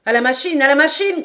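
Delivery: loud, high, repeated call; shouted alarm. A narrator's reading. Engineer calls for help at the engine, shouting. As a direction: cry out, building on the repeat. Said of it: "cria la voix de l'ingénieur".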